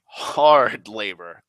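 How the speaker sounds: "hard" stretched long and loud; emphatic stress. Retold or imitated. Man vouching for the kid's work, stressing "hard". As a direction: lean heavily and slowly on "hard".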